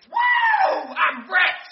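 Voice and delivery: very high shouted "Woo!"; triumphant cheer. Acted. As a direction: celebrate like a kid who struck gold. Kid cashing in fifty cents.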